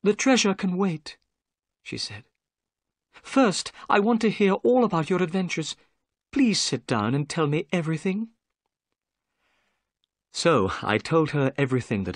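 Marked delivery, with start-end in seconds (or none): 0.0-8.3 a lightly voiced higher young woman; gentle young lady. Miss Morstan greets the narrator, lightly voiced higher and gentle. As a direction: lift the voice for a polite young lady.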